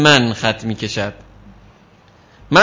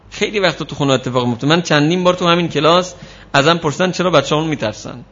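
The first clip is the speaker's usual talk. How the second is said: usual speaking voice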